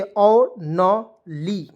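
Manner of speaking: औ न ली spaced out very slowly; syllable by syllable. Shown as a demonstration. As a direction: break only into syllables slowly. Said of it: "क्या पढ़ेंगे". (शब्दांश अलग-अलग धीरे-धीरे)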